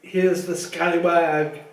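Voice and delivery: loud, booming, pleased with himself; hearty Lockhart. Acted. Vain teacher welcoming Harry into detention, booming. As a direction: greet the boy like a vain celebrity.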